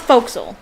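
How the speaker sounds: "forecastle" squashed into two syllables, FOKE-sul; nautical pronunciation. Shown as a demonstration. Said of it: "It's pronounced forecastle".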